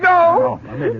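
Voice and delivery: startled outburst; sharp shout. Acted. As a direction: cut her off. Father stops wife.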